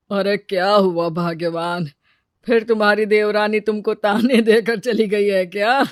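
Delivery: lightly voiced husband; teasing husband. Husband asks his upset wife about her sister-in-law's taunts, lightly teasing. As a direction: ask with a hint of amusement.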